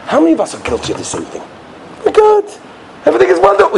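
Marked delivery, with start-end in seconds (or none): 2.1-3.8 very high-pitched mock insistence; complacent protest. Congregants insisting nothing needs fixing, mock-protesting. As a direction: brush off advice in a squeal. Acting out complacent people resisting mussar.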